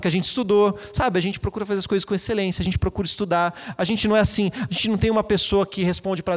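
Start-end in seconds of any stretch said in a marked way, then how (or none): none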